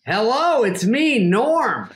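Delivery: high, drawling comic impression; Norm Macdonald. Comedian texting back days later, imitated cheery greeting. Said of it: "Have you ever texted with Norm?".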